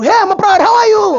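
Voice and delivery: very high, loud greeting; loud gym bro hello. Retold or imitated. Third gym regular greeting him, high and loud. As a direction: shout hello like a pumped gym guy.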